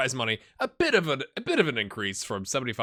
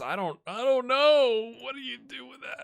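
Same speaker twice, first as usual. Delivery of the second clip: disbelief; 11 semitones higher, 4 dB louder than usual. Real, not acted. Manager stunned by the board's demands, baffled. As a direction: react as if you can't believe it.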